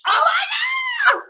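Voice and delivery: very high, drawn-out squeal of delight; excited squeal. Real, not acted. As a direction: squeal it out, thrilled. Squealing over Splash.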